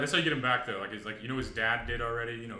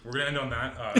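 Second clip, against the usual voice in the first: ordinary talk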